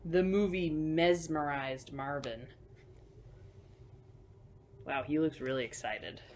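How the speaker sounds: usual speaking voice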